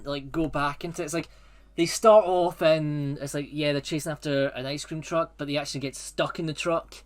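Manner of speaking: ordinary talk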